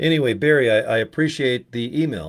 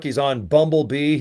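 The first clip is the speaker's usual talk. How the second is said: ordinary talk, nothing marked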